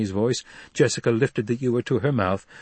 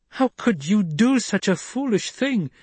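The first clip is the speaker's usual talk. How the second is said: much higher, slow, shocked demand; angry demand; 11 semitones higher than usual. A narrator's reading. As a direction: scold him for his folly. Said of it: "she demanded".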